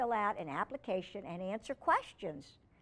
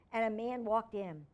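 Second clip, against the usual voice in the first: plain reading voice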